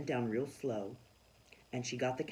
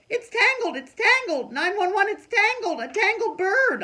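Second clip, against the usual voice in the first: high, loud panicked holler; a girl hollering about the tangled bird; 14 dB louder than usual. Acted. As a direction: holler for help. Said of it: "I hollered".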